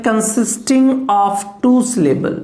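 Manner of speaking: slowed, spaced words with weight on two; slow dictation. Shown as a demonstration. Teacher dictating iambic definition, slow. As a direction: dictate slowly for the notebook. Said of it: "teacher dictating definition".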